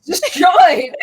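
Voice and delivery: high, loud delighted cry; gleeful exclamation. Real, not acted. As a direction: crow it out gleefully. Mock-devastated reaction.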